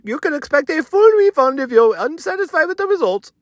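high-pitched put-on salesman voice; high, strained character voice, stretched at the end; tech guy Sushel. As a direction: pitch it way up as the Instagram promoter. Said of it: "He said".

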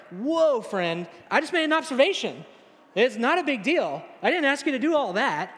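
mock-flustered attempt to rein someone in; alarmed protest. Embarrassed diner calls off an overzealous friend, acting alarmed.

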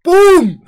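loud, high mock bang; explosion noise. Joking about the attack, a loud mock explosion sound. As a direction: burst out with an imitated blast. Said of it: "Te salió de dentro".

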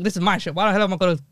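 ordinary talk

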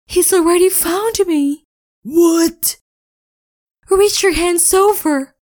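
panicked high voices. Acted. Panicked exchange at fence.

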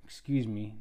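ordinary talk

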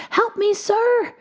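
very high, pleading little-man cry; crying for help. A narrator's reading. Tiny trapped man begs a passer-by for help, high cry. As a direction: cry out high, holding the last word. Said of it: "cried the little man".

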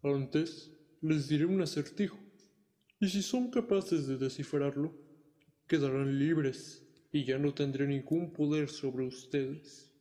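slow, sly devil dragon; friendly dragon voice. Acted. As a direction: tempt them slowly, like a dragon. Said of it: "dijo el dragón".

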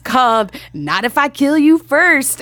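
slow, loud, heavy movie-title delivery; dramatic title read. Retold or imitated. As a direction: announce it like a thriller trailer. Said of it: "And she said it like that".

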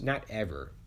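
ordinary talk, nothing marked

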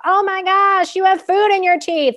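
high, loud blurted call across a table; friend yelling. Retold or imitated. Friend loudly calling out food in someone's teeth, loud yell. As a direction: yell it out so the whole room hears.

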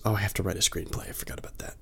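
hushed whisper to herself. Real, not acted. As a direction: whisper it like a guilty aside. Sudden aside to herself.

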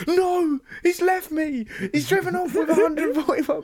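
very high, frantic disbelief; panicked wail. Retold or imitated. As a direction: wail like you've just been abandoned. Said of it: "I'm spinning round a taxi rank going".

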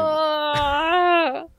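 sudden high startled cry; frightened cry. Retold or imitated. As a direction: gasp out in fright. Acting out Cristina's fright.

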